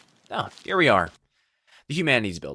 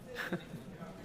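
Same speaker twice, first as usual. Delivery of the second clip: a tiny, very soft laugh; soft shy giggle after admitting it; 5 semitones higher, 13 dB quieter than usual. Acted. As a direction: giggle softly, a little shy.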